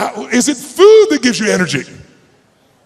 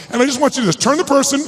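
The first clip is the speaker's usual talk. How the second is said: ordinary talk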